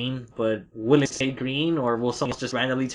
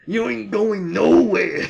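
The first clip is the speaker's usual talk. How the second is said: slow, higher hammed-up tough guy; wrestling promo; 6 semitones higher, 5 dB louder, slower than usual. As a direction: growl it like a ring villain. Mock wrestler threat.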